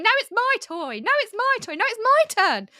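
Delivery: high, loud squabbling toddlers. Retold or imitated. Host mimicking toddlers fighting at the peace table, high loud whiny. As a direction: whine like a three-year-old over a toy. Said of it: "lots of three-year-olds going".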